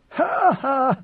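short, high acted "ha, ha"; acted laugh. A narrator's reading. God Emperor amused by a woman's retort, laughing. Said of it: "Leto laughed".

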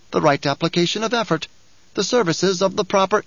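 a sly little dwarf preaching the uses of war; sly dwarf Bijaz. Acted. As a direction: lecture like a gleeful little schemer. Bijaz argues.

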